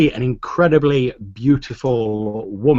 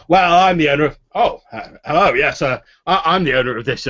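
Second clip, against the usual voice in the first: flustered boasting; 5 semitones higher, 5 dB louder than usual. Acted. Haller turns, boasts, then falters.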